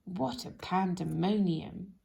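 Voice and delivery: usual speaking voice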